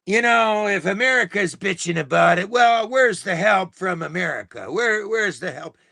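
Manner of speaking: high, whiny mock politician voice; mocking Doug Ford. Mocking the premier to his viewers, high whiny impression. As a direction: sneer his excuse back in a high, put-on whine. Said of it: "he's out there saying".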